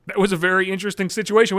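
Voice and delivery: ordinary delivery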